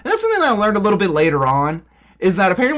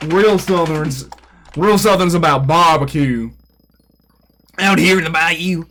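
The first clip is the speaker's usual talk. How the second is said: slow, low Southern drawl; hammed-up deep-South accent; 4 semitones lower than usual. Shown as a demonstration. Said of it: "That's not Southern".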